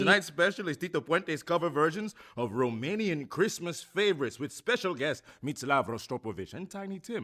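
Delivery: fast-talking sideshow huckster selling records; a hammed-up barker's spiel, swelling to a big finish; carnival barker pitch. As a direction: hawk it like a fairground barker.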